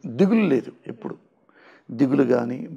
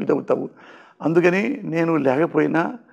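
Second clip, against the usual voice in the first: ordinary talk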